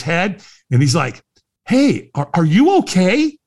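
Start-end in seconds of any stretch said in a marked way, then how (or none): 1.7-3.4 retold concerned stranger. Stranger checks on him, retold.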